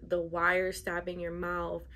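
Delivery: usual speaking voice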